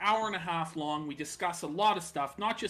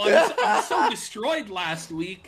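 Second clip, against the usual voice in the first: talking through real laughter; half-laughing mock complaint; 5 semitones higher, 5 dB louder than usual. Real, not acted. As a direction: laugh while protesting. Cohost laughing at donation joke.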